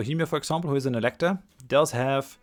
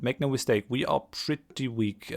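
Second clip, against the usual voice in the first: usual speaking voice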